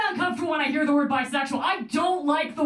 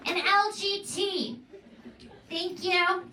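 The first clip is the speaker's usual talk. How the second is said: high, tipsy slurred bar order; drunk girl voice; 6 semitones higher, 5 dB quieter, slower than usual. Retold or imitated. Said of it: "a drunk girl ordering at a bar".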